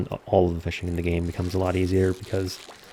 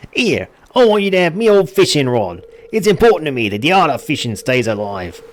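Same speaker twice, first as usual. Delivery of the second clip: high, loud Australian fisherman; Australian accent; 8 semitones higher, 7 dB louder than usual. Acted. Gamer voicing Willy, now in an Australian accent. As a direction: hand over the rod in a broad Australian accent. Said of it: "now he's Australian".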